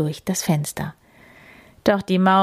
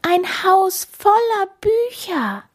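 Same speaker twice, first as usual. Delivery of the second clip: high, delighted mouse voice; beaming mouse; 13 semitones higher, 3 dB louder than usual. A narrator's reading. Mouse showing friends its find, high delight. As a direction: squeak with joy and wonder. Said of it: "strahlte die Maus".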